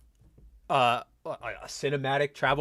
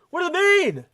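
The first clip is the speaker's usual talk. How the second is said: much higher, squeaky, louder desperate question; high-pitched exasperation; 14 semitones higher, 7 dB louder than usual. Real, not acted. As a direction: throw up your hands and squeak it.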